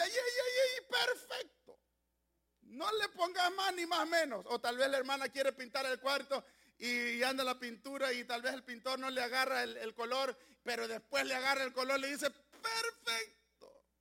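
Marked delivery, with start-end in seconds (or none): 0.0-4.5 delighted cry. Retold or imitated. Voicing a worker measuring something. 12.3-13.9 drawn-out delight. Retold or imitated. Voicing a painter who matched color.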